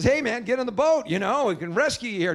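hailing him from the boat; rescuer calling from a boat. Retold or imitated. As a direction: call out to the man on the roof. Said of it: "a guy comes by in a boat and says".

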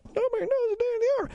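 high, far-off echo of the listeners; mock crowd repeating back. Shown as a demonstration. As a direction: voice the audience answering back. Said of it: "Repeat after me again".

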